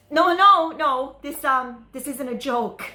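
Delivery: loud, high burst of no's, then easing; urgent protest. Acted. Date insisting the bomb is real, urgent protest. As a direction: insist over their disbelief, starting high and loud.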